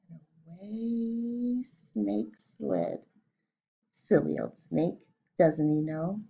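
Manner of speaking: put-on storybook read-aloud for kids; story narration. A narrator's reading. Teacher reading The Gruffalo aloud to her class, storytelling voice. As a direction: tell the tale slowly to the class.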